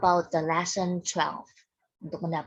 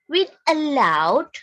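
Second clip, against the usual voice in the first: clear instruction; 5 semitones higher, 6 dB louder than usual. Shown as a demonstration.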